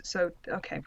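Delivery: usual speaking voice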